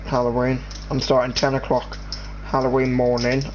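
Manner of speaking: usual speaking voice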